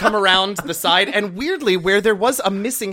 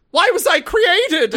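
panicked clone voice; 14 semitones higher, 6 dB louder, slower than usual. Retold or imitated. Clones in show, screaming, retold.

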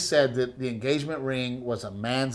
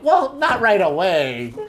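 mock mother-in-law; 5 semitones higher, 3 dB louder than usual. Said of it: "He works hard and".

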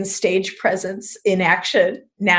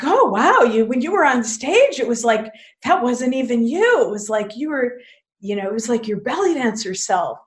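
high-pitched, gushing mock amazement; astonished admirer, voiced high; amazed audience member; 5 semitones higher than usual. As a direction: imitate a wowed fan, pitch swooping up. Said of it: "some people say, like".

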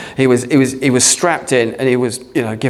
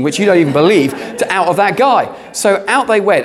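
incredulous; 8 semitones higher than usual. Real, not acted. Pastor marvels at ambulance crew.

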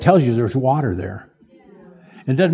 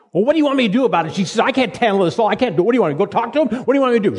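high, fast, exasperated clerk voice; frazzled clerk; 7 semitones higher, much faster than usual. Retold or imitated. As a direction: fire it off like an overwhelmed shop clerk at her limit. Clerk snapping at customer during covid.

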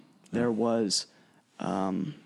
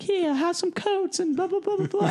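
high-pitched dwarf voice; 4 dB louder than usual. Retold or imitated. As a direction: imitate a dwarf cleric in an absurdly high voice. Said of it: "had a really high-pitched voice".

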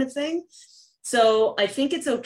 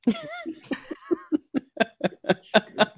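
long high-pitched giggle; laughing. Real, not acted. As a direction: laugh helplessly at your own performance. Laughing after her own re-enactment.

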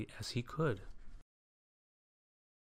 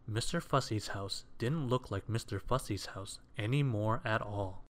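ordinary delivery

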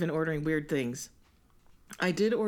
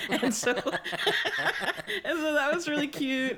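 laughing; 11 semitones higher, much slower than usual. Real, not acted. Laughing at care package.

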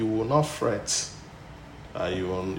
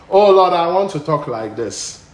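mock wrong prayer; 9 semitones higher, 14 dB louder than usual.

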